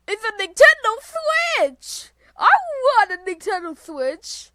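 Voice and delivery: high, loud delight; excited outburst. Real, not acted. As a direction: squeal with wanting it. Excited at seeing console in game.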